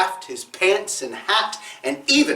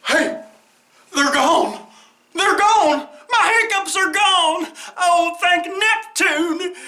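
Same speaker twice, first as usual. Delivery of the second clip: high, loud joyful shout; overjoyed; 11 semitones higher, 3 dB louder than usual. Acted. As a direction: cry out in pure relief. Whale rejoices.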